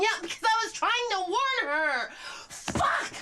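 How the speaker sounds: very high, loud exasperated cry; frantic outburst. Acted. Woman defending her warning to Lucy, frantic. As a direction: build to a furious curse. Upset at her own mistake.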